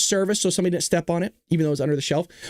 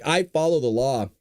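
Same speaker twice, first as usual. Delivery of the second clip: ordinary talk, nothing marked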